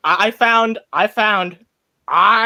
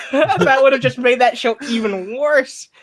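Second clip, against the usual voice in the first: laughing; 9 semitones higher than usual. Real, not acted. Laughing while repeating.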